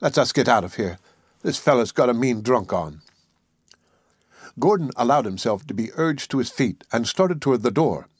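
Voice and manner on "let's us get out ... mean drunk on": plain reading voice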